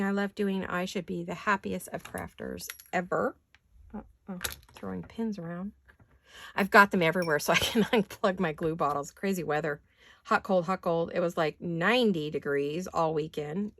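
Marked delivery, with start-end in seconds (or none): none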